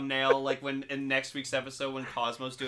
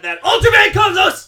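very high, very loud outburst; villain's shout; 18 dB louder than usual. Acted. Doctor Psyche hunts for Ultraman, high mock villain shout. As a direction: yell the hero's name in mock fury.

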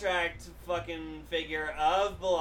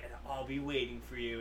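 low ominous reply; 7 semitones lower, 10 dB quieter than usual. Retold or imitated. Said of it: "And Zero's just like".

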